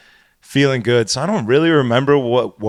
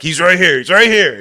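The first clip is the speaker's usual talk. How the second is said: imitating an excited onlooker, higher and louder; eager, raised-voice retold call; 5 semitones higher, 5 dB louder than usual. Friend eagerly pointing out the culprit to the DJ, excited. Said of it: "He's, like, excited".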